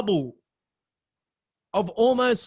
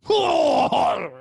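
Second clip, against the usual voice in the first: high, loud startled "Whoa!"; a tripping man crying out; wild yell; 9 semitones higher, 5 dB louder than usual. Retold or imitated. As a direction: let out a wild panicked whoa. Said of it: "this dude just goes".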